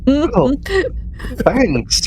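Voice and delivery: normal talking voice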